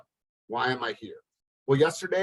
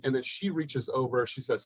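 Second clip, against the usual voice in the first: normal talking voice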